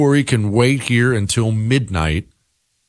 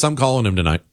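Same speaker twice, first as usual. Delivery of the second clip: ordinary talk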